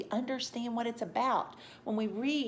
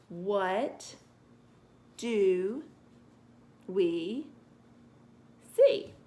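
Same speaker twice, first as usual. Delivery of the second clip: slow, separate words with long pauses; word-by-word reading; 3 dB louder, much slower than usual. Shown as a demonstration. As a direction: read each word on its own, haltingly. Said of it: "let me read that again".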